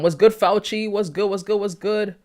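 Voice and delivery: ordinary talk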